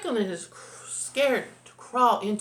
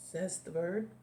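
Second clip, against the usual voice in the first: plain reading voice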